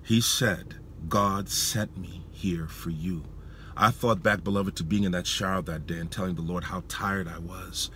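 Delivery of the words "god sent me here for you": slow, soft near-whisper; hushed assurance. Retold or imitated. Visiting preacher assuring a man in the car, hushed and slow. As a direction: say it slowly and very softly, moved.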